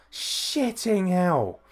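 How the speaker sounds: slow and drawn out in shock; stunned. Real, not acted. Fan reeling from the stomp spot, stunned and slow. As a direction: let it out, still reeling.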